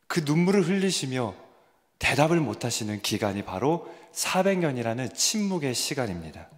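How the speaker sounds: normal talking voice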